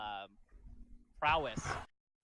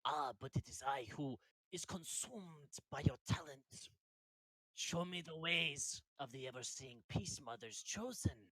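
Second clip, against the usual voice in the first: grand dragonborn; 4 dB quieter than usual. Acted. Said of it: "He's like".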